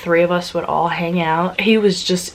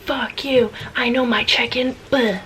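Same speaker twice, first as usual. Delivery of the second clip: mock angry whisper; 5 semitones higher, 4 dB quieter than usual. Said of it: "I was like".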